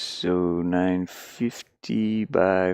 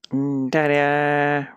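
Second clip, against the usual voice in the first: drawn-out, higher showman's ta-da; playful ta-da; 6 semitones higher, 4 dB louder, much slower than usual. Real, not acted. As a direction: present it like a little magic reveal. Unveiling the new room.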